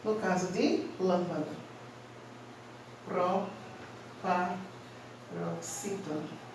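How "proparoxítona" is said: very slow, soft "Proparoxítona" with gaps between parts; syllable by syllable. Shown as a demonstration. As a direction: break "Proparoxítona" into separate, quiet chunks. Teacher spells out term by syllables.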